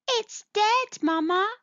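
very high little-creature voice; little mole. Acted. Little mole looks at a shriveled bulb, high-voiced.

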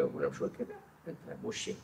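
whispered, conspiratorial aside. Acted. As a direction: drop to a whisper, like a secret. God's whispered aside about hypocrite. (همس جانبي)

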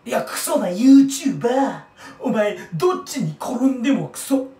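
mock retort. Self-retort in put-on voice.

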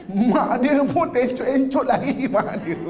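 slow, laughing through the words. Real, not acted. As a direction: chuckle as you tell the picture. Comic image of fleeing mother. (bercakap sambil ketawa)

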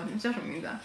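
usual speaking voice

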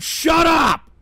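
loud, high snapped order; angry yell. Real, not acted. Telling the TTS voice to shut up, loud and angry. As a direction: shout it at the machine.

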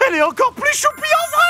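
very high gushing squeal; fanboy squeal. Acted. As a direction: squeal like an excited fan. Fan gushing over idol.

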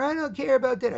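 high, loud mock-child protest; whining boy. Boy defies mom over dinner, imitated loud whining. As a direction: imitate a kid yelling back in a whine. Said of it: "he's whining".

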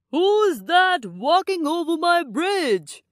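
high, loud monster scream; monster's scream. A narrator's reading. Monster screams at the goat crossing his bridge, loud and high. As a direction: bellow it like an angry troll under the bridge. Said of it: "screamed the monster".

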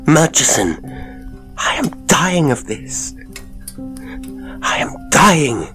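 high, desperate outburst; anguished cry. A narrator's reading. A haunted man cries out to Murchison that he is dying, desperate. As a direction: plead in rising anguish, pitched high. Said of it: "he cried".

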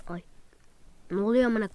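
ordinary delivery

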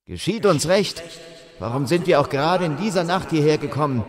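exclaimed. A narrator's reading. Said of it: "rief der vierte aus".

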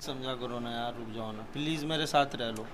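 very soft, low plea; hushed pleading. Real, not acted. Man pleading with partner not to go, hushed. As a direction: beg quietly, voice dropped. Man begs her to stay.

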